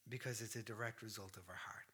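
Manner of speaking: hushed, soft; whispered emphasis. Real, not acted. Preacher to congregation about Abel's heart, whispered. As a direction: drop to a whisper to drive it home. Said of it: "whispered emphasis".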